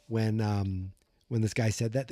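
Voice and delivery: ordinary talk, nothing marked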